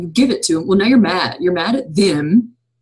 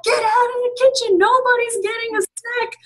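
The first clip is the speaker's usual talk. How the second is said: much higher hammed-up frantic mom rant; mock exasperated mom. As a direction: play a fed-up mom banishing kids from the kitchen. Mom snapping at snacking kids.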